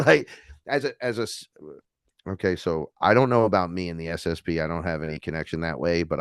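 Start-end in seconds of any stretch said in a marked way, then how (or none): none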